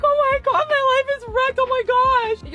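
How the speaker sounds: high, loud mock wailing; exaggerated high-pitched despair; mock meltdown. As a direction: wail it like life is over, hammed up. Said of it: "I was not like".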